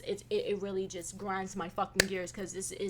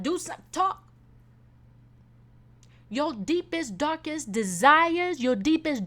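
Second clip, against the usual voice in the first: higher, louder fervent preaching; 6 semitones higher, 7 dB louder than usual. Real, not acted. Urging listeners to pray, fervent preaching. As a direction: urge them to talk to God.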